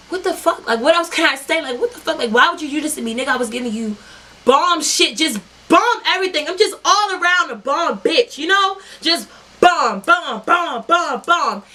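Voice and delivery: high-pitched wounded venting; hurt outburst. Retold or imitated. Retold crying to ex.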